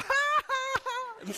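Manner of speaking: high, hammed-up fake laugh; mock delayed laugh. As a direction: mimic a late ha ha ha. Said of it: "Some of y'all about Wednesday are gonna go".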